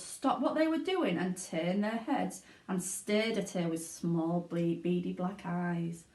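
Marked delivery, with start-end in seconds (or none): none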